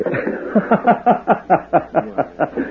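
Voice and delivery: long, high put-on laugh; hearty mock laugh. Stranger goads the cafe owner, hearty mock laugh. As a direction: laugh it up to needle the cafe man.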